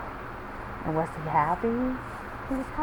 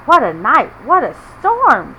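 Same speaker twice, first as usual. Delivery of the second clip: 1 mild excited woodland critters; 5 semitones higher, 10 dB louder than usual. Acted. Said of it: "Mole mutters".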